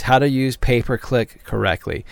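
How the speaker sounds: usual speaking voice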